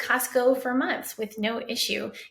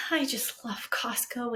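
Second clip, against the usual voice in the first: happy sigh; 2 semitones higher, 5 dB quieter, slower than usual. Retold or imitated. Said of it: "he sighs happily".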